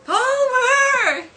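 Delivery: very high, loud, held call; neighbour's exasperated holler. Acted. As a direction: stretch the name into a yell. Flanders calls out again.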